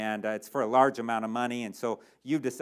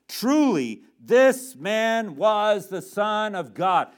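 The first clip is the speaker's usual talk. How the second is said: loud, high, slow proclamation; emphatic declaration; 7 semitones higher, 7 dB louder, much slower than usual. Acted. Centurion at the crucifixion declaring who Jesus is, loud proclamation. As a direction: ring out each word with conviction.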